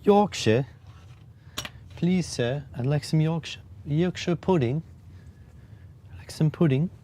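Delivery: put-on a lightly done English accent, clearly not an actor; bad British accent. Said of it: "none of my accents are good".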